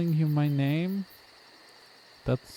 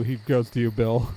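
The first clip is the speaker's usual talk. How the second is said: ordinary delivery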